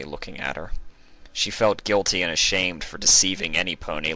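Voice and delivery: plain reading voice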